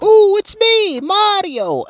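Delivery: high-pitched imitation of a game mascot; Chris Pratt as Mario. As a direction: mimic the catchphrase way up high. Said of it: "when he was like".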